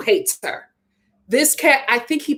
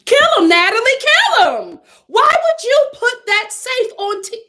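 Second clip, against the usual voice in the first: high, raised-voice scolding; exasperated outburst; 12 semitones higher, 4 dB louder than usual. Real, not acted. Viewer scolding Natalie over the safe, exasperated outburst. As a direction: cry out in high-pitched disbelief.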